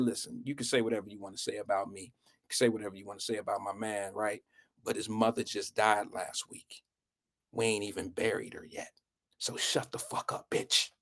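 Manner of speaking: very soft, low and controlled; hushed warning. Retold or imitated. Friend confronting a girl about his buddy's dead mother, quiet and low. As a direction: deliver the threat under your breath.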